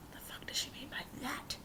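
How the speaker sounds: baffled whisper; whispered thought. Retold or imitated. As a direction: whisper it in confusion. Confused inner thought.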